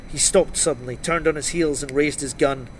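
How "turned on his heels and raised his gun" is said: ordinary delivery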